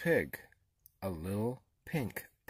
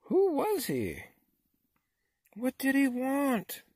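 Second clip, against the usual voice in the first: very high, louder baffled questions; animals crying out; 6 dB louder than usual. A narrator's reading. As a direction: call out urgently in bewilderment. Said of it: "the animals cried".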